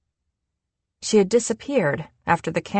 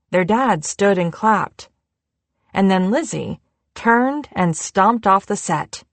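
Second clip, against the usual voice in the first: plain reading voice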